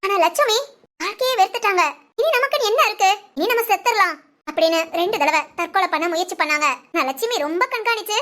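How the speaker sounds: low, steady storyteller's voice; story narration. A narrator's reading. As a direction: narrate the twist to the viewers.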